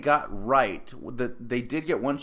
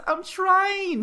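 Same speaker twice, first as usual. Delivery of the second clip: much higher, whining; mock whiny girl; 6 dB louder than usual. Daughter whining back at her dad, high and louder. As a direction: whimper it like a helpless girl.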